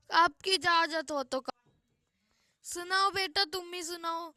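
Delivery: ordinary delivery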